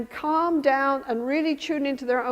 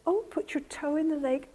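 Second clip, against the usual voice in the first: soft, slightly raised mothering voice; gentle coaxing; 3 semitones higher, 6 dB quieter than usual. Acted. Mother coaxing toddler into the lake, gentle. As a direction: invite a toddler softly. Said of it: "gradually the mother says".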